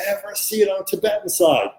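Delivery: ordinary delivery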